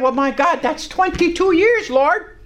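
high, incredulous protest; taken aback. Retold or imitated. Preacher recalls answering the Lord's promise, taken aback. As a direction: protest upward in astonishment. Startled reply to the Lord.

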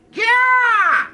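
high, loud, drawn-out affirmation; emphatic shout. Real, not acted. Preacher answering a doubter, emphatic high shout. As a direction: belt it out high and long.

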